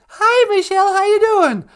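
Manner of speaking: squeaky high puppet voice, loud; high falsetto wife voice. Acted. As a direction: falsetto Michelle puppet greeting. Puppet sweetie voice for Michelle.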